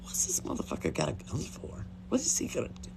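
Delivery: hushed, barely voiced muttering; hushed aside. Teen quietly wondering about the boy's gun, hushed aside.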